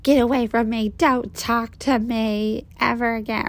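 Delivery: high, mock-furious brush-off; angry outburst. Girl snapping at the guy to get away, high mock anger. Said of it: "she was just like".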